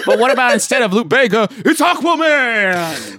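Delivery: high, loud burst stretching the last word; over-the-top excited suggestion; mock-excited pitch. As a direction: pitch a silly idea with huge enthusiasm.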